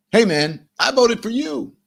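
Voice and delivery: hammed-up desperate appeal; pleading cry. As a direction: call out to him, arms wide. Acted plea to voter.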